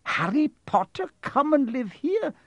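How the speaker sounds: very high, agitated protest; appalled cry. A narrator's reading. McGonagall objecting to Harry living with them, appalled. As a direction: protest loudly in horrified disbelief. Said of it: "cried Professor McGonagall".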